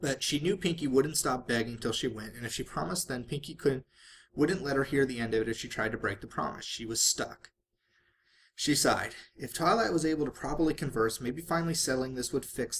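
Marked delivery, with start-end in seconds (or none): none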